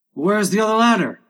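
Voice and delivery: high, loud workman's shout; calling out. Acted. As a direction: shout across to the crew. Many voices shouting at work.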